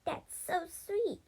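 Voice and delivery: high, slow, tearfully touched; acted weepy gratitude, high and slow; blubbering. A narrator's reading. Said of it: "she blubbered".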